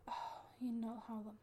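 ordinary delivery

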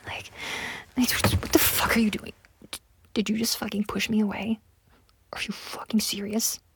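usual speaking voice

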